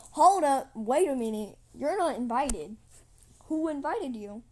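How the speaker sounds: loud, commanding challenge; indignant queen. Acted. Queen confronting uninvited Trigger, loud and indignant. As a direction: stop the intruder at full volume.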